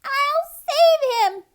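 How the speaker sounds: very high, loud squeak; squeaking squirrel. A narrator's reading. Squirrel volunteering to rescue duck, squeaking. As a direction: squeal it out slowly as a tiny rescuer. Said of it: "squeaked the squirrel".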